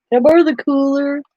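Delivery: high, sweet asking voice; butter mom up for a favor; sheepish son asking. Retold or imitated.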